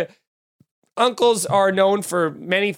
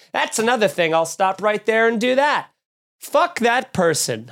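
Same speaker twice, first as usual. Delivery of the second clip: normal talking voice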